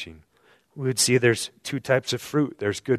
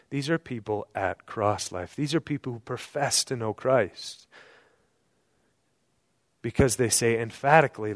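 ordinary talk, nothing marked

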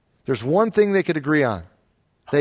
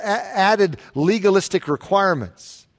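usual speaking voice

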